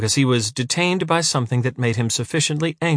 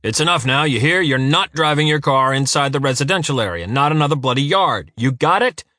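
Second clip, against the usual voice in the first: loud, raised angry shout; 4 semitones higher, 4 dB louder than usual. A narrator's reading. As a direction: yell it across the street. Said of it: "shouted Uva in the distance".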